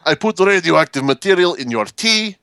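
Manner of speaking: high-pitched mock Russian menace; bad Russian accent. Putin playing by his own rules, bad Russian accent. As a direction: confess it in a fake Russian accent. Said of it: "very bad attempt at a Russian accent".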